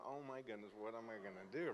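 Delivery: softly murmured worry, to himself; quiet panicked thought. Retold or imitated. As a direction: mutter it like you just found no paper.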